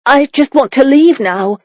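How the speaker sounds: desperate. A narrator's reading.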